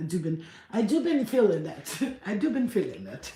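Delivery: giggling through the words; real laughter mid-sentence; laughing. Real, not acted. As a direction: laugh it out, amused at herself. Laughing at her own phrasing.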